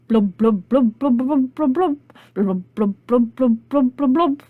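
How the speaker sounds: rhythmic bubbly blubs; bubbling-water noises in time; brook bubbling. Acted. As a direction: blub like water keeping the beat. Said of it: "So the brook bubbled down the road".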